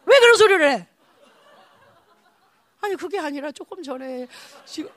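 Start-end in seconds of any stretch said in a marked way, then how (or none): 0.1-0.9 loud, high exasperated rebuke; imitating an irritated pastor telling someone off; acted scolding. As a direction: scold them in mock outrage, voice raised.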